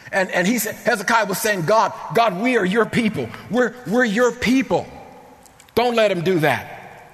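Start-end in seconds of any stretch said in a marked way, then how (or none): 1.7-7.1 raised, urgent voice of a praying king; impassioned retold plea. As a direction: cry out to God on behalf of the people, as retold. Hezekiah begs God to save his people.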